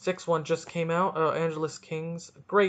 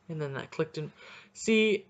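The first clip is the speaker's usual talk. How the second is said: ordinary talk, nothing marked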